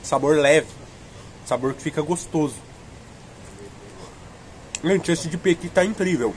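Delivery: speaking between bites; chewing cold ice cream; mouth full of popsicle. Real, not acted. Seen 4: Popsicle at his lips, biting it repeatedly while speaking.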